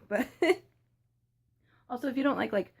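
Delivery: talking with a mouthful of food; chewing while talking; mouth full. Real, not acted. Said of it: "I'm gonna try and not talk with my mouth full".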